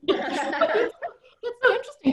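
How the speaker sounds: laughing. Real, not acted. Laughing response to crying joke.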